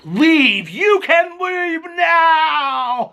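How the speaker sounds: high, drawn-out mock shout; imitating Meredith. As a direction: bellow an exaggerated order to leave, stretching the last word out. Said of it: "it was like Darth Vader from Star Wars".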